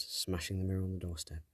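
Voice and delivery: ordinary delivery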